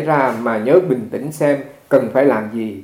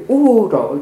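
loud, high "oh heavens"; retold loud cry of shock; alarmed outcry; 8 semitones higher, 6 dB louder than usual. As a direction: yelp of dismay. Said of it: "La lên cái".

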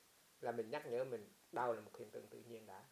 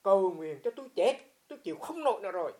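louder, higher cry of a dying man; desperate plea; 5 semitones higher, 14 dB louder than usual. Retold or imitated. Monk voices dying man begging for prayer, loud desperate plea. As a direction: beg to die, voice straining. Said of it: "Tôi nói".

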